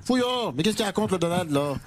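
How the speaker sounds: put-on thick comic provincial French accent, stammering in indignation. Mayor protests remark on his accent, spluttering provincial French accent. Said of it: "ce joli petit accent provincial".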